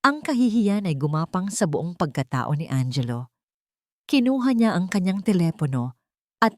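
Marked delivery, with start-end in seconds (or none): none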